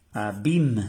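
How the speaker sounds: "Abim" said loudly, French a-BEEM; French abîme. Shown as a demonstration. Teacher to class, loud French "Abim". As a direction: model "Abim" firmly, French style. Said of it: "que quiere decir abismo".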